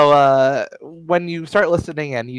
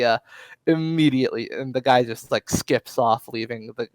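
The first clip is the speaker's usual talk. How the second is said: usual speaking voice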